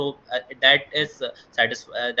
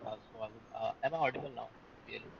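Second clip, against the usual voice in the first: faint, far-off voice on a bad mic; very low, hard to hear; barely audible; 16 dB quieter, faster than usual. Real, not acted. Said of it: "your voice is very low".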